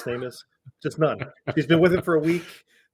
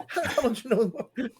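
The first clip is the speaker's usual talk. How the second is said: slow, higher, laughing through the question; laughing quote; 9 semitones higher than usual. Retold or imitated. Retelling a classroom scene, laughing and higher. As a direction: giggle out a kid's disbelief.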